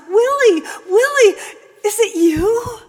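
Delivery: high, loud overjoyed calling of a long-lost son; mother's tearful delight; joyful mother's cry. Acted. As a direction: cry out his name in disbelieving joy. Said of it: "ran to him and threw her arms around him".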